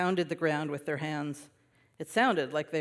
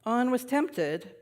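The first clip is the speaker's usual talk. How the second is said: plain reading voice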